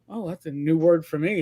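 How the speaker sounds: usual speaking voice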